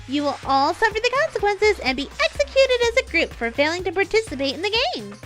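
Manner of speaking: high, loud, hyped announcer voice; fighting game announcer. Acted. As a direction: boom it out like a show host. Announcer voice in story.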